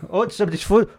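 ordinary delivery

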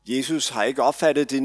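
ordinary delivery

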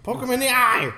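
high, loud excited yell; shouting. Real, not acted. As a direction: yell it at the screen. Shouting at screen.

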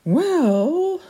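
high, drawn-out imperious well; the Peregrine, sighing. Acted. The Peregrine breaking the silence, high and haughty.

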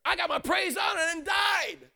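high-pitched, jubilant praise story; excited testimony. Acted. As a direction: act out an exuberant believer telling it. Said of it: "you should say something like".

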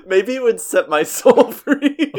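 laughing through the words. Real, not acted. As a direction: giggle out each word. Laughing while saying it.

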